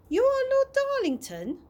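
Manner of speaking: Chloe's voice jumps high and loud in disbelief; astonished question. A narrator's reading. Chloe realises he is lord.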